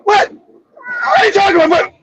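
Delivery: retold outrage. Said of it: "I was pissed off".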